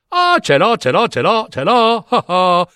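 high-pitched, gleeful tumble of repeats; eager excitement. Acted. Simpleton suitor has just the thing for her, eager.

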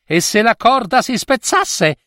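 high-pitched, doubtful question; hesitant king. A narrator's reading. King doubts the old woman's rope plan, hesitant. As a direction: voice a king's misgiving. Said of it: "Il re un po' esitava".

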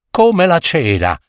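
usual speaking voice

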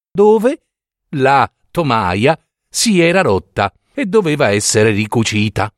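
usual speaking voice

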